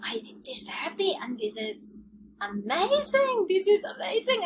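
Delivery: thrilled retold exclaim; excited. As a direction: burst out with the news. Retold joy at recovery.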